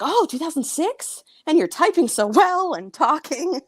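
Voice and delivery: high-pitched, sarcastic amazement; mock disbelief. Retold disbelief at diagnosis claim.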